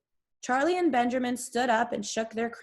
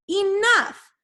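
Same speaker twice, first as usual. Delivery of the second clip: much higher, louder shouted command; chilling command; 10 semitones higher, 8 dB louder than usual. A narrator's reading. A chilling stranger silencing the reporters, shouted command. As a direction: slam the word down to end it. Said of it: "such a deep and chilling voice".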